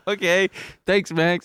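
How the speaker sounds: awkward high-pitched thanks; nervous high voice. Retold or imitated. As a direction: squeak it out nervously. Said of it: "And I was just like".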